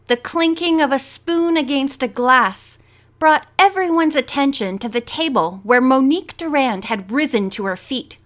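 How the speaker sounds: plain reading voice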